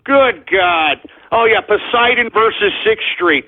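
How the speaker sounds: ordinary talk, nothing marked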